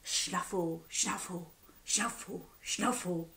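soft, snuffly sniffing sound; hedgehog snuffle. A narrator's reading. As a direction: snuffle like a hedgehog nosing around. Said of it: "went the hedgehog".

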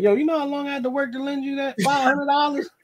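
high, exasperated complaint; indignant. Retold or imitated. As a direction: call them out for the loan.

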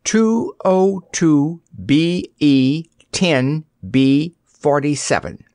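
slow, spaced-out number reading; reading out slowly. A narrator's reading. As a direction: read each item separately and deliberately. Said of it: "read the little girl".

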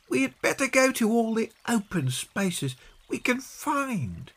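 put-on very high, deliberate child's voice; Peggy. Peggy working out a search plan, high child voice.

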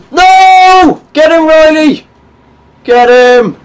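high, loud yelling at the screen; raging shout. Real, not acted. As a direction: scream at a teammate to get him. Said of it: "I liked my raging".

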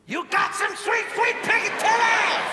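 high-pitched shouted outburst; mock spirit message. TV medium blurting out grandmother's message, high shout. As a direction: belt it out high, stretched at the end. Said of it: "She says".

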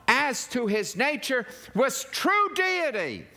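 high, driving sermon delivery; impassioned preaching. Real, not acted. Preacher proclaiming the nature of Messiah, impassioned. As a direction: build point by point to a ringing climax. Preaches the climax at full force.

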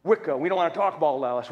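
usual speaking voice

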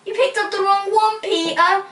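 high, loud scolding; exasperated wife's telling-off; chiding mum. A narrator's reading. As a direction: scold your husband, pitch right up. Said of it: "chided Mum".

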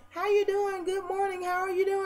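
very high-pitched mocking imitation; high mock helium greeting. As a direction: mimic her squeaky helium voice, far above your own. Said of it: "stop huffing helium and shit".

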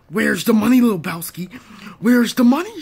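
shouting for the money; loud demand. Acted. Thug demanding money, loud. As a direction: bark it like a thug.

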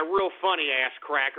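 ordinary delivery

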